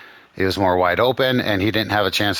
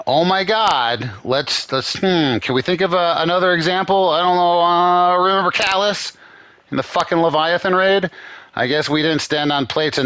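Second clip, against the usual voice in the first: higher mock-innocent voice, sarcastic; 8 semitones higher than usual. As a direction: fake wonder, dripping sarcasm. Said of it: "I'm being fucking sarcastic".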